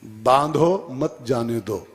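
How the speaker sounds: slow, pause after "बांधो", then "मत जाने दो" together; measured "बांधो, मत जाने दो"; written order. Shown as a demonstration. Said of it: "लिखित मैटर दिया".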